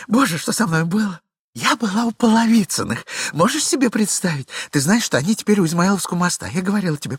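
high, loud, breathless excuses; chattering lady. Acted. Glafira rattling off excuses to her husband, high and fast. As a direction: talk him dizzy with a tale.

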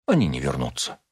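low, composed contradiction; calm. A narrator's reading. Rachel calmly contradicts her husband about his patients. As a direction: answer calmly, dropping the pitch. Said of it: "спокойно сказала Рахель".

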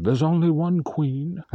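high, slow, regal British imitation; upper-class British accent. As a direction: mimic a haughty British girl, drawn out. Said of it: "high upper class accent".

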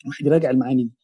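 ordinary delivery

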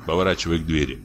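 ordinary delivery

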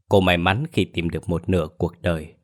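ordinary delivery

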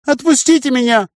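high chirpy pleading voice, far off; chirping creature. A narrator's reading. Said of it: "прощебетала тварина".

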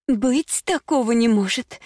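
громко и высоко, в истерике; возмущённо вскрикивает; истеричный возглас. A narrator's reading. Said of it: "истерично воскликнула Прилс". (истерично, на высокой ноте)

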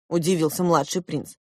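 ordinary delivery

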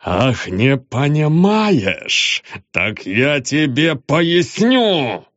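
slow menacing rage; furious. A narrator's reading. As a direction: drag it out, seething. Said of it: "Полковник взъярился окончательно".

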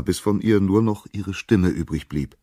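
ordinary delivery

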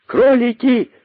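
very high-pitched cry; shrieking rabbit. A narrator's reading. Rabbit cries from the boa's belly, shrieking. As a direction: call out to all at piercing pitch. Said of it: "кричал он".

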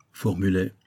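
plain reading voice

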